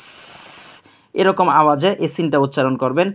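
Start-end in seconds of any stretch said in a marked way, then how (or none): 0.1-0.7 long voiceless "sss" like escaping air; imitated air leak; leaking tyre hiss. Shown as a demonstration. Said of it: "leak হয়, তখন একটা শেষের আওয়াজ বের হয়".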